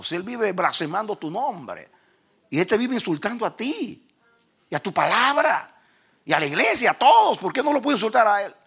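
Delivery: protesting to God. Retold or imitated. Preacher reenacting his protest about the devil, high and heated. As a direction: argue back in a rising, incredulous plea. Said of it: "Y le digo: "Señor".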